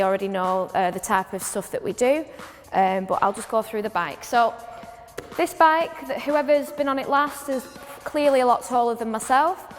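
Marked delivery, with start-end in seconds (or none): none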